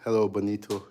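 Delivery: ordinary talk, nothing marked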